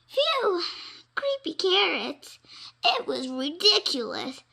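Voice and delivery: high, loud relieved outburst; relieved exclaim. Acted. Rabbit brushing off his fear, relieved and loud. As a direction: blow it off with a nervous laugh. Jasper relieved, then scoffing.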